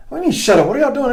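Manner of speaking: mock-outraged "what do you mean"; indignant. Retold indignant reply to shushing.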